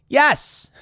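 a high, loud, blunt "Yes."; loud incredulous yes; emphatic yes. Retold or imitated. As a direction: slam the answer down flat. Retorting to libertarian.

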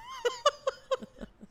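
squealing cackle; a high-pitched cackling laugh. Real, not acted. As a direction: cackle high at your own roast. Roast joke lands.

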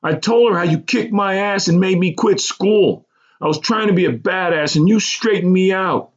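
high, gushing confession; excited. A narrator's reading. Man thanking schoolmate for old beating, excited. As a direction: tell it proudly, pitched far up.

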